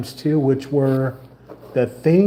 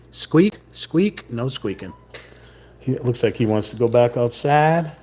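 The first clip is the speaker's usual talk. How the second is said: normal talking voice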